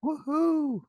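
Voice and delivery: high whooping woohoo; host cheering the guest's advice; excited cheer. Real, not acted.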